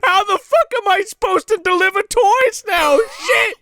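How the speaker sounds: high-pitched mock outrage; pissed-off Santa. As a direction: rant as a hammed-up, furious Santa. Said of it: "Santa is fucking pissed".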